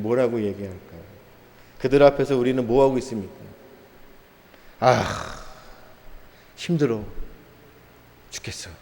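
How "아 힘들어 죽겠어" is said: mock weary groan. Believers groaning about hardship.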